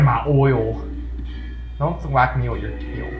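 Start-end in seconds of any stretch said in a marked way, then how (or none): none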